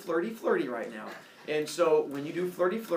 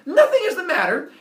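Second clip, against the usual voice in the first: "Nothing" blurted much higher and louder; annoyed outburst; 16 dB louder than usual. Acted. Husband on the phone snapping at his suspicious wife. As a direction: snap back defensively.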